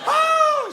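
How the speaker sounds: very high, loud single whoop; whooping crane laugh. Shown as a demonstration. Comedian demonstrates laughing like a bird, high whooping crane call. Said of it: "like a whooping crane".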